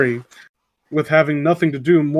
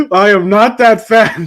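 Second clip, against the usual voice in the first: loud, slow, offended protest; mock indignation; 5 semitones higher, 6 dB louder than usual. Offended cat to carver of its likeness, mock indignation. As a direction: protest with a laugh underneath.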